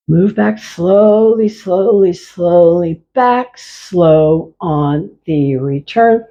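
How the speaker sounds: drawn-out, unhurried cues; slow motion. Shown as a demonstration. Teacher cueing the slow return, slow and drawn out. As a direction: ease them back at half speed. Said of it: "set the intention to move back slowly".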